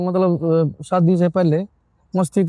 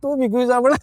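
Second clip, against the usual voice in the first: normal talking voice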